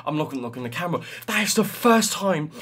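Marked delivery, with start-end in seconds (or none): 1.3-2.5 higher, buzzing with joy; overwhelmed and elated; breathless excitement. Real, not acted. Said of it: "I'm breathless right now".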